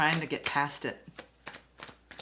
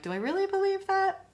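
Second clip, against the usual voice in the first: much higher voice, voicing inner doubt; high questioning voice. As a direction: pitch it way up as a question.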